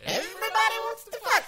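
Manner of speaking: high sped-up chipmunk voice; squeaky cartoon chipmunk. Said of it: "now we've got chipmunks fucking".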